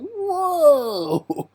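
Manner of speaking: shocked whoa. Retold or imitated. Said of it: "It's like".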